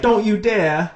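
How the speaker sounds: hammed-up mother forbidding her daughter; mother snapping at her daughter not to leave; stern mum. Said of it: "and then Jackie's like".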